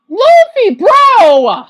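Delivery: loud, high, drawn-out yell; exasperated shout. Real, not acted. Viewer yelling bro at Luffy on purpose, exasperated. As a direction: shout it and drag it out.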